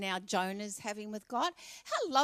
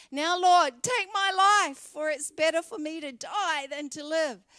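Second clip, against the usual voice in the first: begging to die, loud and high; anguished loud plea; 6 semitones higher, 8 dB louder than usual. Acted. Jonah crying out to God to take his life, loud despair.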